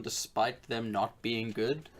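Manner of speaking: usual speaking voice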